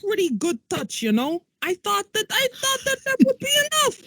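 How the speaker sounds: put-on high, strained demon accent, exasperated; a weary old demon whining. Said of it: "that is the voice of a demon".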